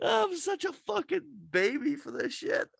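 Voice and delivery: high, cracked laughing sobs; crying while laughing. Real, not acted. Retelling the Spider-Man scene to friends, laughing through real tears. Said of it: "I'm crying right now".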